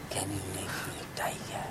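whispered, timid request to the sage. Acted. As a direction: whisper the wish shyly. Dog asks secretly for tiger.

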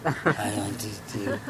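low, soft menacing tiger. Acted. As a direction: say it low and quiet like a hungry tiger. Tiger answers the sage.

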